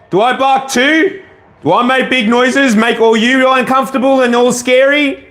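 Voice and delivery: high teasing pet voice; teasing the dog. Real, not acted. Owner teases her dogs about their big noises, high teasing voice.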